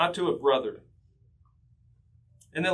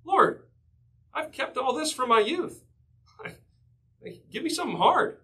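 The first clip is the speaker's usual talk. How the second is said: much higher, boastful voice with a laugh, fast at the end; smug rich young ruler; 8 semitones higher than usual. Retold or imitated. Preacher mocking the rich young ruler's boast to the Lord, smug and high. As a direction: play the self-satisfied young man bragging to Jesus. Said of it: "The rich young ruler".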